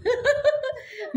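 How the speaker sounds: short high-pitched laugh; real delighted laugh. Real, not acted. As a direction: mom laughing at her daughter's drawing. Child says she draws mom.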